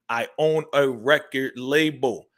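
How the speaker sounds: deliberate, drawn-out "I own a record label"; slow, pointed quote. Retold or imitated. Commentator repeats her claim for listeners, slow and pointed. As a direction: spell it out slowly, word by word. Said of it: "Now, hear what she said".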